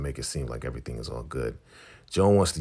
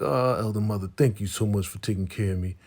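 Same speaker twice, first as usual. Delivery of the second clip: mock grateful voice; 6 semitones higher than usual. Retelling show scene, grateful character.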